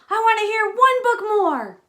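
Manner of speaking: loud bedtime protest; dinosaur shout. Acted. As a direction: shout it like a stubborn little dinosaur. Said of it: "stomp his feet on the floor and shout".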